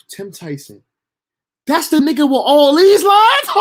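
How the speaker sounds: high, loud disbelieving yelp; shocked disbelief. Retold or imitated. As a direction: squeak out the shock at all those lines. Shocked at the big role.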